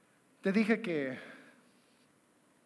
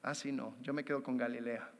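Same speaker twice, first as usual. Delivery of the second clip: soft, lower mock reluctance; reluctant refusal; 10 semitones lower, 14 dB quieter than usual. Believer imitating himself refusing Jesus, soft and low. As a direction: back away gently, half-hearted. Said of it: "Y dices".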